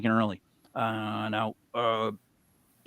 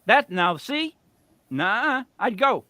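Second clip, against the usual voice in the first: excited; 8 semitones higher, 3 dB louder than usual. Real, not acted. Excited at ventriloquist museum.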